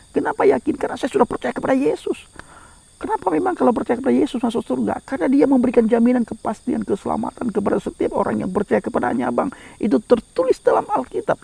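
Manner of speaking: high-pitched evangelistic preaching; impassioned preaching. Acted. Preacher asks who is ready for heaven, impassioned preaching. Preaches with force, acting out dialogue.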